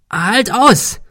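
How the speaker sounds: high yell down to someone; urgent shout. A narrator's reading. Man encouraging his dangling friend, shouting urgently. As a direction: shout it to keep him hanging on. Calls to friend hanging on rope.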